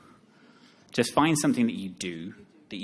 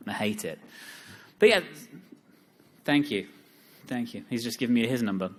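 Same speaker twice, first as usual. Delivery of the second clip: normal talking voice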